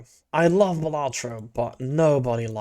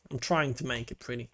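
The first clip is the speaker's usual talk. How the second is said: muttered to self; 3 semitones lower, 10 dB quieter, faster than usual. Real, not acted.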